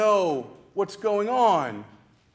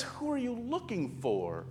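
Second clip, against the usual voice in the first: soft question; 2 semitones higher, 11 dB quieter than usual. Acted. The gardener figure asks Mary.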